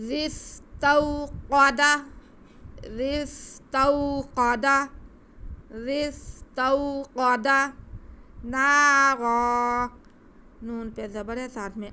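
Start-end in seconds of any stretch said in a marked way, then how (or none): 0.1-7.5 استوقد with a thick, full-mouthed ق; slow recitation stressing a heavy ق; heavy qaf. Shown as a demonstration. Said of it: "اسے ہم موٹا کر کے پڑھیں گے". 8.4-9.9 نارا with the first alif held one beat, very loud and slow; stretched recitation of نارا; long alif. Shown as a demonstration. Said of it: "ایک الف کے برابر کھینچیں گے".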